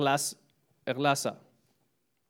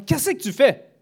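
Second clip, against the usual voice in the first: high, loud imitated parent; a parent's loud, high question to a child; 11 semitones higher, 10 dB louder than usual. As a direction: imitate a father calling to his child. A parent asks his child.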